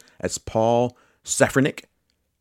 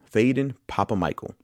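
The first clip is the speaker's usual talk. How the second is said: ordinary delivery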